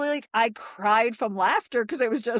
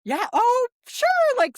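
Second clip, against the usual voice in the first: high, loud burst of agreement; excited; 13 semitones higher, 5 dB louder than usual. Real, not acted. Guest eagerly agreeing with the host, high and loud.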